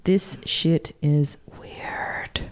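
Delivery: slow unvoiced whisper. Host spooks her co-host with a slow whisper. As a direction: hush down to a creepy whisper.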